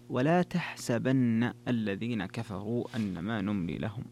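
تحسبن said "tahsabanna", starting with t; measured recitation with تحسبن as "tahsabanna"; Hamza's ta reading. Shown as a demonstration. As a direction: recite slowly, تحسبن opening on "tah" with "sa" after. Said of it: "وتفرد حمزة ب".

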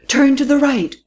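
high, urgent called direction; cried out. A narrator's reading. Companion shouting directions to the driver, high urgent cry. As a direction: call the turn out sharply. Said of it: "cried Delivet".

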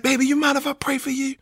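high, soft-spoken nurse asking gently; tender nurse. Retold or imitated. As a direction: voice a caring nurse, pitched well up. Nurse's tender words retold.

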